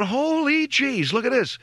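slow, high gasp of disbelief; mock wide-eyed wonder; wife amazed. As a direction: imitate the wife staring at the old car. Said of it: "Maria's going".

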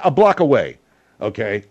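ordinary talk

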